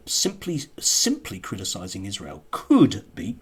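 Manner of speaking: ordinary delivery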